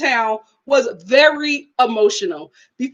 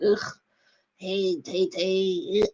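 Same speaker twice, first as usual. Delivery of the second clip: slow, quiet, low wheezy greeting; feeble old man; 5 semitones lower, 8 dB quieter, much slower than usual. Retold or imitated. Imagined ailing dad greeting Tay Tay, feeble old man. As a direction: greet her like a frail grandfather, very slowly. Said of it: "expected to see somebody like".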